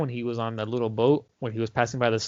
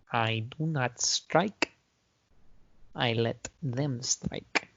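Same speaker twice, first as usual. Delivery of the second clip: slow, solemn kung fu master; lightly voiced sage, very slow; wise monk voice; 6 dB quieter, much slower than usual. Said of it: "philosophical monk shit".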